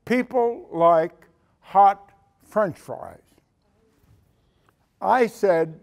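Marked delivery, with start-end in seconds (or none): 0.1-3.2 slow, weighty "People like hot French fries"; slow emphatic repeat. Retold or imitated. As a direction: drive the punchline home slowly. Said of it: "That's what he said".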